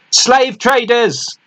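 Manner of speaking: high, slow drawn-out scream; screamed slowly. A narrator's reading. Lookout screaming a warning to the crew, slowly. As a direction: stretch the warning out in terror. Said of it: "the lookout screamed".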